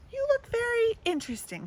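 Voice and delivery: a mother choosing her words kindly; mother diplomatically replying to her child; mother's voice. Acted.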